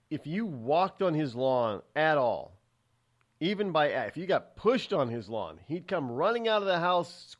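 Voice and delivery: ordinary delivery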